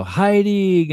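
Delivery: put-on high, loud sing-out like kids; excited little boys' greeting. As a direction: call out like boys greeting their dad. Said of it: "The boys will go".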